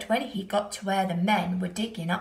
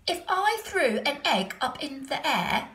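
put-on boy's voice; 7 semitones higher, 5 dB louder than usual. A narrator's reading. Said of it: "said Stephen".

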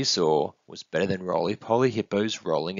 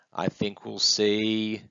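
slow, last word stretched; suspenseful guess; slower than usual. Acted. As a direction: draw it out while thinking up an answer. Little one guesses with suspense.

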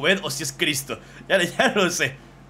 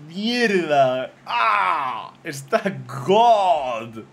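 thrilled outburst, drawn out on the last; excited shouting; 3 semitones higher, 6 dB louder, much slower than usual. Real, not acted. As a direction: yell it like you can't believe it. Raves about fan drawing.

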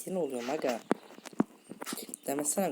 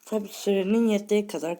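talking with mouth full; 7 semitones higher, 6 dB louder than usual. Real, not acted. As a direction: mouth full of food, chewing. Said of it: "ağzımda yemek var".

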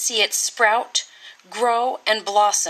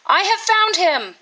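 exclaiming; 7 semitones higher than usual. Acted. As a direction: announce it to the whole court with delight. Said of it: "he exclaimed to one and all".

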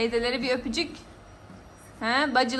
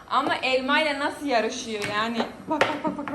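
ordinary talk

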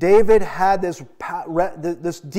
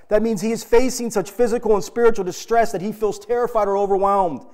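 usual speaking voice

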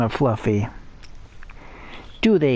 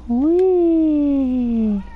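gleeful whee; 11 semitones higher, 4 dB louder than usual. Real, not acted. Jumping around like an idiot.

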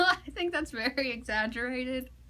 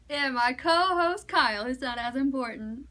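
morning show host; 7 dB louder than usual. Pretend. Host opening a pretend morning show, hammed-up announcer.

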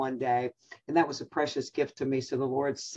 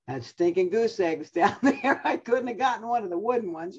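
laughing; 5 semitones higher than usual. Real, not acted. Laughing at broken treasured ornament.

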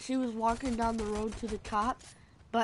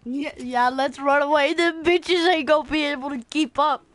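hyped; 4 semitones higher, 8 dB louder than usual. Real, not acted. Fleeing in game, hyped.

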